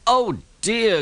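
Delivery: high, strained groan of dismay; a worried little engine in pain. A narrator's reading. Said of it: "groaned Thomas".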